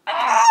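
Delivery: short, loud high-pitched shriek; excited scream. Real, not acted. Fan celebrating a favourite band, excited shriek. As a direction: squeal out loud like a thrilled fan.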